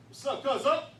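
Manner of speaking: put-on calling out loud and loose to a kid; swaggering shout across the street; loud street greeting. Older guy hollering a greeting to the kid.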